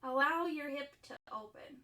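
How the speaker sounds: softened, measured cueing with long silences; guided yoga instruction. Acted. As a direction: guide them through breath and pose, leaving room between phrases. Leads breathing and yin yoga poses.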